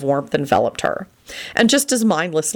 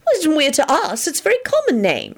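high-pitched, matter-of-fact reply; practical; 11 semitones higher than usual. A narrator's reading. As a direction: state it as simple fact. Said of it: "responded in a practical voice".

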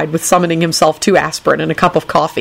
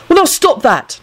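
high-pitched mild scolding; stern; 11 semitones higher than usual. A narrator's reading. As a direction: tell them off firmly. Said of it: "Neville said sternly".

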